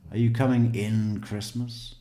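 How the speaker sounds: Maryland phrasing. Retold or imitated. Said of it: "They say".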